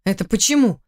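plain reading voice

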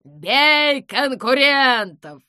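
slow, loud battle cry; shouting. A narrator's reading. Ugukha jumps between cat and duck, shouting. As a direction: bellow it like a charge. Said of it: "неожиданно закричала Угуха".

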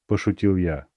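plain reading voice

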